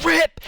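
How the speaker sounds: quick, high rip noise; sudden high-pitched zip; imitated ripping sound. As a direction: mimic a cord being yanked. Imitates parachute cord ripping.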